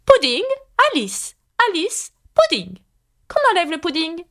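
sulky grumble. A narrator's reading. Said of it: "prit un air maussade et grommela".

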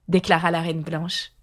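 ordinary delivery, nothing marked

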